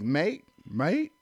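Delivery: imitating a New Zealander's accent on "mate"; British-like accent. Man mimicking the woman he met, New Zealand accent. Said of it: "like a British accent".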